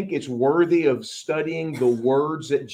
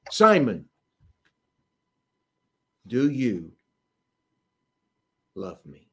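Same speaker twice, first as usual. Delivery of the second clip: tender question; 5 semitones lower, slower than usual. Acted. Jesus asking Peter gently.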